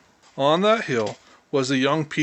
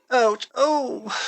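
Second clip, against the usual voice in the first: sharp ouch then groaning oh; pained groans; 8 semitones higher than usual. A narrator's reading. Mouse hurt by owl groans in pain. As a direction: cry out in pain as you try to rise. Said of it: "groaned Danny".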